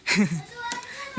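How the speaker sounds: soft little chuckle; short, quiet real laugh; amused chuckle. Real, not acted. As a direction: laugh quietly after your own teasing. Jokes about reckless-driving mothers.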